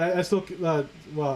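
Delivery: usual speaking voice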